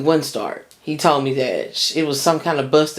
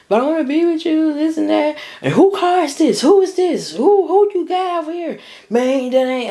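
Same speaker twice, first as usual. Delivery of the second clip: much higher imitated girlfriend, begging; pleading girlfriend; 13 semitones higher than usual. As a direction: squeak out her pleading like a mimic. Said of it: "And she was like".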